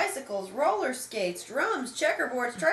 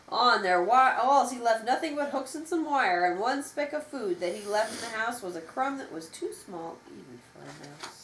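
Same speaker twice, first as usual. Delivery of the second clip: usual speaking voice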